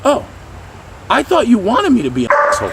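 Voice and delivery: high, quick innocent realization; surprised dog. Acted. Dog explaining itself to the trainer, high quick surprise. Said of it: "the dog goes".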